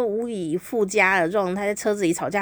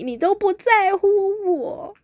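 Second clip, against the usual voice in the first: mock whine; 10 semitones higher than usual. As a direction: drag it out like a neglected girlfriend. Girlfriend whining hungry.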